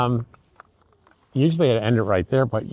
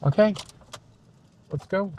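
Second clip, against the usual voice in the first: high, sing-song coaxing the dog; talking to a dog; 9 semitones higher than usual. Real, not acted. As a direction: coax the dog along brightly. Calling the dog along.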